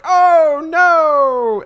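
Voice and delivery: high squeaky oh no; squeaky dismay. Acted. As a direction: yelp it like a crushed old man. Daedalus dismayed before dying.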